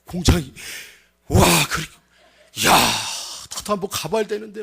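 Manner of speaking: hushed, breath-filled wonder; awed villagers. Retold or imitated. Villagers gaze at the cousin, acted hushed awe. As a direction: marvel softly in broken phrases. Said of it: "동네 사람들이 그냥 막 그걸 쳐다보면서요".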